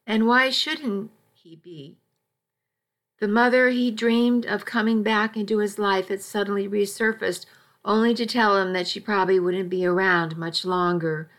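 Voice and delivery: plain reading voice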